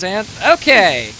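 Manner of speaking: normal talking voice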